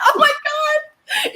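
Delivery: high, drawn-out laughing exclamation; laughing disbelief. Retold or imitated. Fan recalling mistaken comments to the comedian, laughing disbelief. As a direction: replay amused shock, slowly, through laughter.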